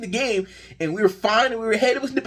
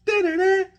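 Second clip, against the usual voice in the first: imitated alert tone; 10 semitones higher than usual. Notification tone imitated.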